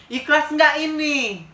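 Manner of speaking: imitating the beggar woman's slow threatening challenge; menacing challenge. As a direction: loom over her, stretching every word.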